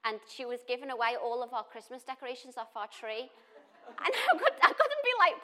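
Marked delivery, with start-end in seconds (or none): 3.9-5.3 high, loud words through real laughter; laughing. Real, not acted. Telling the church about the decorations, laughing high. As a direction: try to speak while laughing.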